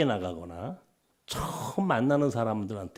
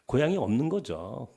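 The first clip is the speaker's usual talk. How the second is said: ordinary delivery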